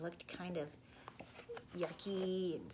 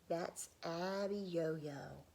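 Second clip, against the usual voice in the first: slow ominous naming; 2 semitones lower, much slower than usual. Acted. Father names the giant.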